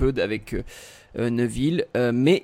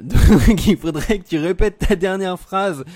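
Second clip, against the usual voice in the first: laughing, louder and higher; 6 semitones higher, 8 dB louder than usual. Real, not acted. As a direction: laugh through the request. Laughs after connection freeze.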